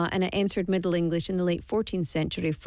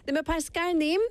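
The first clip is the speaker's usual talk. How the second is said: usual speaking voice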